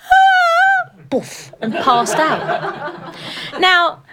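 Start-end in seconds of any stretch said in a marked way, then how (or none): 0.0-0.9 sudden high shriek as she faints; queen faints behind the screen with a high cry; fainting cry. Acted. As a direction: let out a swooning gasp and drop. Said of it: "poor young queen has gone".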